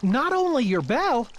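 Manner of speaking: plain reading voice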